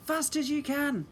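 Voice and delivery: high loud holler. Acted. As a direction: shout it over the storm. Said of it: "I hollered".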